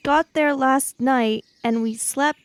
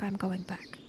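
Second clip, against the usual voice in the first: whisper; 5 semitones lower, 14 dB quieter than usual. Acted. Quiet determined whisper.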